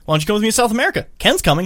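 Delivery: eager fast invitation; mock eager invite. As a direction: rush the pitch like a salesman. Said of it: "And Izzy's like".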